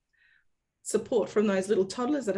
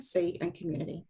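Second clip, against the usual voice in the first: ordinary talk, nothing marked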